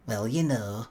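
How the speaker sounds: lightly put-on Blackpool woman's voice; Blackpool accent. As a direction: dodge the question with a hint of Blackpool accent. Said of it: "is that a Blackpool accent you've got".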